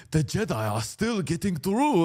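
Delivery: hammed-up Neimoidian accent from Star Wars; mock Neimoidian villain accent. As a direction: do a comic Neimoidian alien accent. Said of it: "it's a Nemodian accent".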